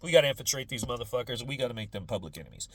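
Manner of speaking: mock FBI agent. FBI agents plotting against the hippies, low and fast. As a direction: play a low conspiring fed. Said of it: "the F B I is like".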